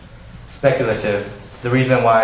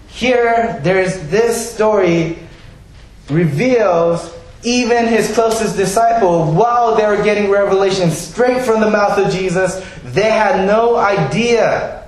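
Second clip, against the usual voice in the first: fervent preaching; 8 semitones higher, slower than usual. Real, not acted. Preacher builds with emphasis.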